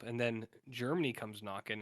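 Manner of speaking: ordinary talk, nothing marked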